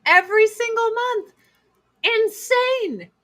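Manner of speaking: squeaky high repeat, each word hit; hammed-up shrill self-mockery; high mock-crazed voice. Repeats punchline in a put-on voice.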